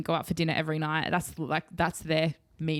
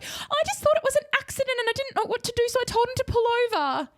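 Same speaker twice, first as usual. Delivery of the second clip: high, quick, innocent explaining; innocent mum excuse; faster than usual. Retold or imitated. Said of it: "She was like".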